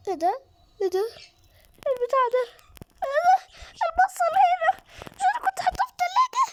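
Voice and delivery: high, loud panicked cries; terrified shrieking. Real, not acted. Scared player shrieking at the creepy game, crying out. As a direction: shriek in real fright, near tears.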